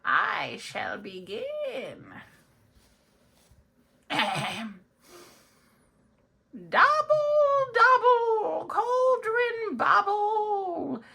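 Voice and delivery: high, slow theatrical wizard chanting a spell; cackling sorcerer casting a spell, very high and loud. Acted. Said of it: "Mister Wizard?".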